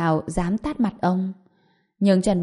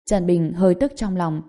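ordinary delivery

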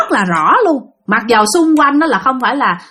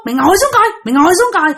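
higher, insistent retold command; urgent urging; 6 semitones higher, faster than usual. Husband pushing his friend to sit, raised urgent urging. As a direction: act out pressing someone to obey.

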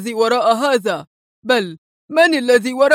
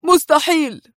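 higher, curt outburst; sharp denial to a doubting colleague; 6 semitones higher than usual. A narrator's reading. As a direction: snap it back, dismissive. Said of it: "صاح جولدمان في حدة".

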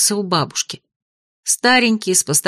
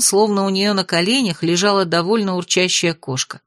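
ordinary delivery

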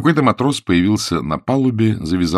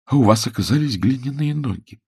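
higher-pitched, wounded reproach; slightly tearful; 5 semitones higher than usual. A narrator's reading. Woman reproaches her fallen idol, slightly tearful. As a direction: play her on the edge of a light sob. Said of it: "Она слегка всхлипнула".

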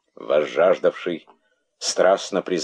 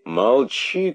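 high, sharp command; shouted order; 7 semitones higher than usual. A narrator's reading. King silencing the herald, shouting. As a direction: cut him off with a raised voice. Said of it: "крикнул король".

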